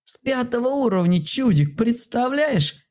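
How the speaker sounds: very high squeaky little creature voice; joyful squeal. A narrator's reading. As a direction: squeal it out in delight. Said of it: "радостно вскрикнула она". (радостный писк маленького существа)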